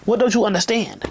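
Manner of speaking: ordinary delivery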